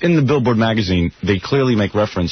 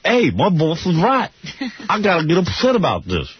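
higher, slow Gary voice; imitating Gary; 6 semitones higher, slower than usual. Host imitates producer Gary convincing himself, higher and slow. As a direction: mimic Gary's slow realisation. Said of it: "you say to yourself".

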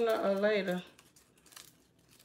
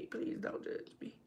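whispered plea; 13 dB quieter than usual. Real, not acted. Pleading asides to viewers.

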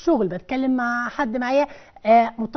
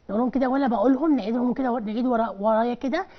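ordinary talk

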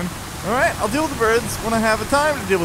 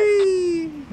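very high, sustained squeal; playful whee; 13 semitones higher than usual. Pretend. Player watching plane pop balloons, high gleeful whee. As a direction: let out a long, high whee.

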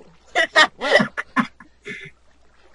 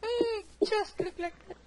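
squealing excitement; 13 semitones higher than usual. Real, not acted. Cooing over a shaped object.

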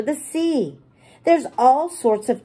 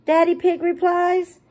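usual speaking voice

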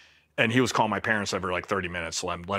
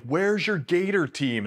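drawn-out, higher demand for the missing team; baffled officer asking where his rescue team went; incredulous; 8 semitones higher, slower than usual. Retold or imitated. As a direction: ask slowly, disbelieving, pitch up.

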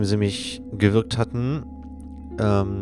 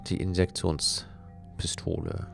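whisper; 8 dB quieter, slower than usual. A narrator's reading. Discovers the injection gun.

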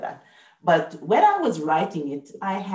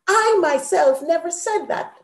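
I myself stressed, high and loud; more emphasis; 11 semitones higher, 4 dB louder than usual. Shown as a demonstration. Teacher contrasts plain and emphatic sentence, with emphasis. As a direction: lean hard on "I myself". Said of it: "more emphasis".

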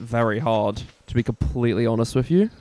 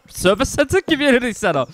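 high mock correction; 11 semitones higher than usual. Mocking Aussie correction.

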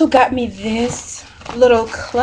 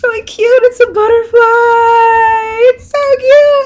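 very high, drawn-out gushing over a gift; moved, delighted squeal; 11 semitones higher, 5 dB louder, slower than usual. Real, not acted. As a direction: squeal as if happiness might bring tears. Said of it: "try not to cry".

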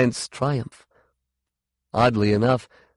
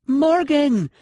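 high, loud shout of a name; 5 dB louder than usual. A narrator's reading. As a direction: shout it sharply, pitched way up. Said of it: "she shouted".

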